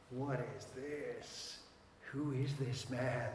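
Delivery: soft, slow wonder; hushed amazement at the calm; awed hush. Pretend. Disciples' awe voiced.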